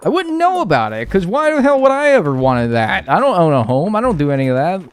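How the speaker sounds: high, loud, heated ranting; worked-up rant. Real, not acted. Streamer railing at deceitful jingle marketing, worked-up rant. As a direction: rant with real passion, pitch jumping up. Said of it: "Guy gonna calm down".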